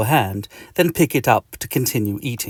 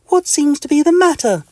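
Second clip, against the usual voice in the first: very high, louder lady doctor voice; Missus Doctor; 7 dB louder than usual. Acted. Doctor asks the itchy patient, high put-on voice. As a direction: pitch it high, a doctor's enquiry. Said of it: "asks Missus Doctor".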